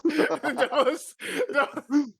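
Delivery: high, cracking up between words; losing it mid-story; real laughter breaking through; helpless laughter. Real, not acted. Cracking up, can't continue.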